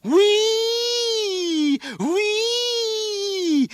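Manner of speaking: very high siren squeal; long, loud wailing "whee"; screaming like an engine. A narrator's reading. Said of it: "screaming like some kind of engine".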